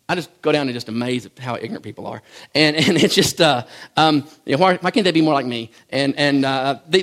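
ordinary talk, nothing marked

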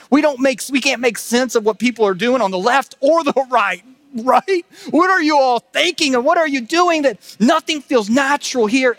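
high, loud sermon at full force; impassioned preaching. Real, not acted. Preacher telling congregation this earth isn't home, impassioned.

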